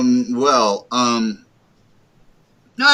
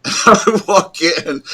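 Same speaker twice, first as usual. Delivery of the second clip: laughing; 11 semitones higher, 8 dB louder, slower than usual. Real, not acted. Laughing while retelling story.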